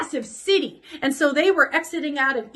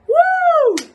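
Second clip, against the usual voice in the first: high whooping cheer, louder; excited cheer; 13 semitones higher, 6 dB louder than usual. Real, not acted. Teacher cheering at lesson start, excited whoop. Cheering after announcing the topic.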